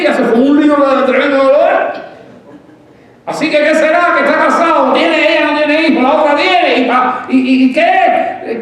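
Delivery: loud, high, driving sermon voice; a pastor raising the voice on rival wives; fervent preaching. Real, not acted. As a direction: preach it at full force to the congregation. Preacher builds to full force.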